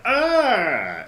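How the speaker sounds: startled cry. Acted. Men recoil from spikes.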